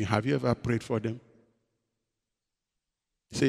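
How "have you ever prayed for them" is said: low, soft divine question; God's gentle rebuke. Retold or imitated. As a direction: quietly ask as God. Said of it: "And God said to me".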